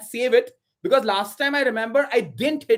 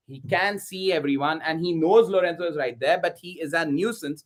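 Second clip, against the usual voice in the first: usual speaking voice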